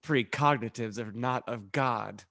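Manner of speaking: ordinary talk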